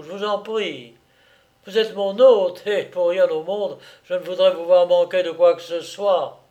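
high, lively offer of hospitality; jovial host. Acted. Captor offering his prisoner breakfast, jovial. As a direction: play the over-courteous host, listing each choice with a lift.

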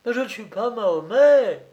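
high, loud protest; distressed cry. Acted. Unfortunate Dumolay denying he is Mahomet, crying out. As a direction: cry out as the wronged man. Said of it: "cria l'infortuné Dumolay".

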